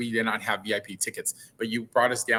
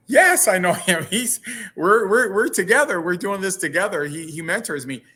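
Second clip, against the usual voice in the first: higher and louder, bursting with pride; excited reply; 6 semitones higher, 5 dB louder than usual. Retold or imitated. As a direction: blurt it out, thrilled he knows him. His own excited reply, retold.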